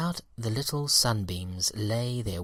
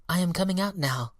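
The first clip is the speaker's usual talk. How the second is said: eager cry; 8 semitones higher than usual. A narrator's reading. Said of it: "cried the Daffodil Baby".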